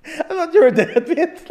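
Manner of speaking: high laughing quote. Retold or imitated. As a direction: squeeze it out through laughter. Quoting his own reply.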